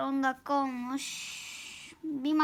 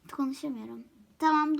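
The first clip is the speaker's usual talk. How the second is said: usual speaking voice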